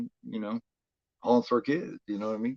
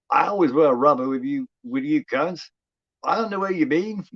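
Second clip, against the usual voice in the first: loud, higher-pitched Aussie movie quote; Wolf Creek Australian accent; 6 semitones higher, 8 dB louder than usual. Retold or imitated. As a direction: imitate the film's Australian accent, pitched up high. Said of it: "One of my favorite parts of, uh, Wolf Creek".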